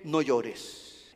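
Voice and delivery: soft, low consoling words; tender comfort. Pretend. Jesus comforting the widow of Nain. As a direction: gently soothe the widow.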